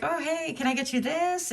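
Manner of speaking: high falsetto offer. Retold or imitated. Admirer offering a gift in high falsetto. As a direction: chirp it up high, eager to please. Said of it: "I'm using my falsetto voice".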